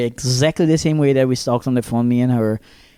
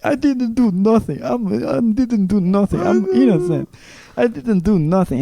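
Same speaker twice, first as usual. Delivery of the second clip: high-pitched, insistent protest of innocence; mock panicked protest; 8 semitones higher than usual. Son insisting to his dad he didn't do nothing, high mock protest. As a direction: plead innocence in a rising voice, hammed up.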